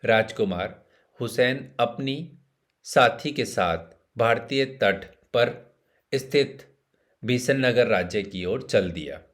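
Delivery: ordinary delivery, nothing marked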